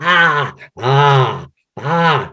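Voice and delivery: roar attempt. Shown as a demonstration. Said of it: "try to roar".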